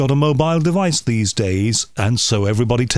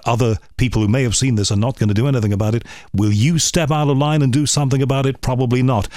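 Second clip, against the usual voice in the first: ordinary talk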